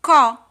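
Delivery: co punched very loud; syllable beat. Shown as a demonstration. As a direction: hit the syllable co hard. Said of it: "No terceiro".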